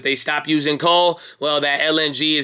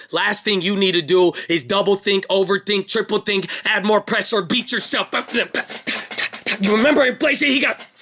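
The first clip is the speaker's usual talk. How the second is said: rising higher and higher into a hype climax; over-hyped; 4 semitones higher than usual. Pretend. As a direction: build the pep talk up to a high-pitched peak. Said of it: "this is too much hype".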